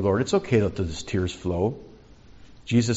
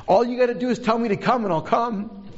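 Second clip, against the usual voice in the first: high, eager plea with a laugh in it; eager Peter; 12 semitones higher, faster than usual. Acted. Eager disciple begging the Lord to let him come, excited. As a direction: pitch it up, keen and impatient to go.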